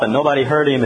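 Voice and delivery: usual speaking voice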